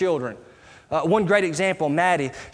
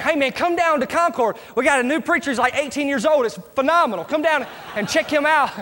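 high, excited pitch to a coworker; acted-out excited invite; enthusiastic invitation; 8 semitones higher, 3 dB louder than usual. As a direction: talk up the new preacher with eager energy.

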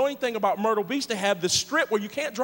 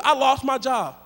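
ordinary talk, nothing marked

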